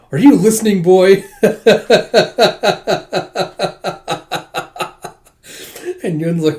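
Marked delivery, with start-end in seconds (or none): 0.1-1.2 loud, high scolding question; raised-voice acted impatience; demanding attention. Character demands the boy's attention. 1.3-5.1 high, loud drawn-out cackle; long rhythmic "ha-ha-ha" laugh; long staccato laugh. Acted. Long laugh after the demand.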